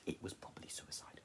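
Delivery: whispered aside. Real, not acted. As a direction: let it slip in a whisper.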